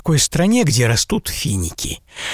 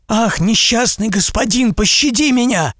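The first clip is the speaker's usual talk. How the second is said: high, begging cry; frightened plea for mercy; 10 semitones higher than usual. A narrator's reading. Merchant begging the giant to spare him, frightened high cry. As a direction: beg for your life in terror. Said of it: "испуганно вскричал купец".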